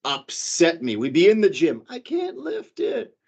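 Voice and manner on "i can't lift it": high mock whine, imitating his wife; mock whiny girlfriend at the gym; whiny wife quote. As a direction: put on a higher whine and draw it out. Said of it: "she used to say".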